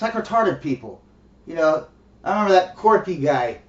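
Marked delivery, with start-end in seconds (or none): none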